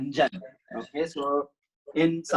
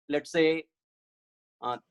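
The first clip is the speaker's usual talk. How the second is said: usual speaking voice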